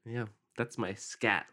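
ordinary delivery

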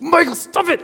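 hammed-up irritated friend; mock annoyed yell. Friend annoyed at Michael's cereal noise, mock yell.

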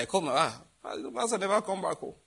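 ordinary talk

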